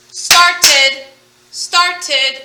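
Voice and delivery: started sounded out loud as start-ed; two clear syllables. Shown as a demonstration. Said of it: "there's two syllables".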